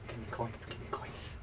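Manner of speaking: hushed, greedy chant of gimme coins; greedy whisper. Real, not acted. Player whispering greedily for loot. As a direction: whisper it like a miser eyeing loot.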